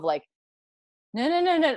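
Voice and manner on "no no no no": high, rapid string of noes; mock panic. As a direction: voice a panicked silent look, lightly. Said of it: "like, panicked because the look".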